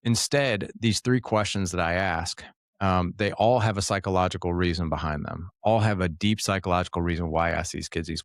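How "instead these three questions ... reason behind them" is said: ordinary talk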